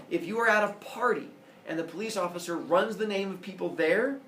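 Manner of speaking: put-on a judge scolding a young offender from the bench; stern judge. Judge warning a teen in court.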